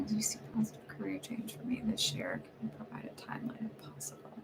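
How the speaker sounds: very soft, whispered read-through; reading under breath. Real, not acted. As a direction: whisper the question to yourself as you read. Reading a typed question to herself.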